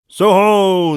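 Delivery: long, loud, high sung-out call; roaring hail. A narrator's reading. Guard challenging an unseen rider, roaring hail. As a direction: sing it out as loud as you can. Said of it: "sang out, as loud as he could roar".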